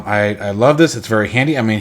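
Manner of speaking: usual speaking voice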